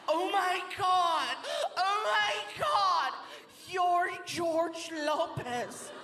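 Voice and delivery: high, very drawn-out gushing; mock starstruck female voice, stretched out; freaked-out fan. Dancer mistakes comedian for a star, high hammed-up freak-out. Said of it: "She freaked out".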